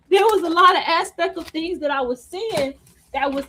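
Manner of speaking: ordinary talk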